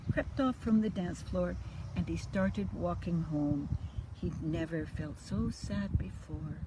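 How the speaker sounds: lively storybook read-aloud; reading to children. A narrator's reading. Grandma reading a bedtime story, lively and sing-song. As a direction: bring the rhymes alive for little ones. Reads picture book to children.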